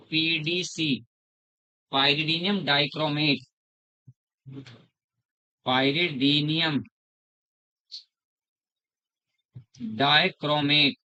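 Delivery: very slow, syllable by syllable; slow dictation. Shown as a demonstration. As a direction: spell out "pyridium" and "dichromate" slowly for notes. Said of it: "teacher spells out reagent name". (बहुत धीरे, शब्द-शब्द बोलकर लिखवाना)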